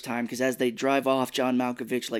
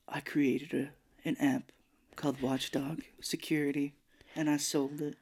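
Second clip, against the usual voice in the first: creepy hushed voice; 8 dB quieter than usual. Retold or imitated. Creepy host describes his app.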